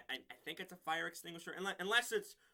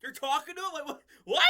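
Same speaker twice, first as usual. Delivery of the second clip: high, incredulous imitation of shock; hammed-up panic, breaking into laughter; mock freaking out; 9 semitones higher, 7 dB louder than usual. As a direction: act out scandalized disbelief, rising to a squeal. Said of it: "Everyone's freaking out".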